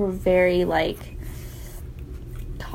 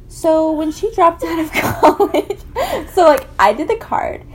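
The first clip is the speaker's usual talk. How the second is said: words broken by giggles; laughing; 7 semitones higher than usual. Real, not acted. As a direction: laugh through the telling. Laughing while retelling.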